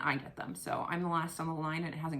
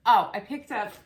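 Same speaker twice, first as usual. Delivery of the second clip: loud "Oh", remembering; surprised exclamation; sudden recall; 3 semitones higher, 13 dB louder than usual. Real, not acted. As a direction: blurt it as it comes back.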